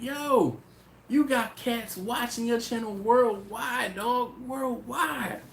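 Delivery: high, slow, drawn-out hype voice; hyped friend. Retold or imitated. Friend tells host people worldwide watch, high slow hype. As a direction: stretch out worldwide like big news.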